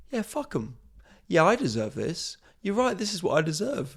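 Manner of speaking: a touch of gloating high self-satisfaction; smug rich voice. Pretend. Comic imagines feeling rich on a yacht, mildly gloating. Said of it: "have this feeling rise up inside you that goes".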